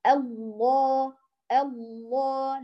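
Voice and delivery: الله stretched very slowly, lam thick and full; heavy lam drawn out. Shown as a demonstration. Teacher repeating الله slowly for students. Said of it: "ولكن اللامان يفخمان".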